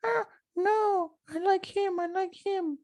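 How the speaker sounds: high, squeaky put-on voice pleading he likes him; cartoonish high-pitched manager impression; Paul Heyman impression. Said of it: "Paul Heyman's gonna be like".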